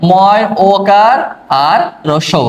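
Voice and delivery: ময় ওকার আর রস্যৌ spelled out very slowly; showing the standard reading of মৌ; slow pronunciation demo. Shown as a demonstration. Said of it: "প্রমিত উচ্চারণ কি হবে জানো?".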